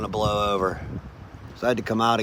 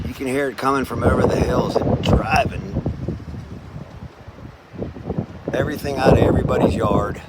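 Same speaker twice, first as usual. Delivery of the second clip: shouting over wind. Real, not acted. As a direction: call out over a gale. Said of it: "I don't know if you can hear me over the wind".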